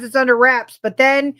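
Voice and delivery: normal talking voice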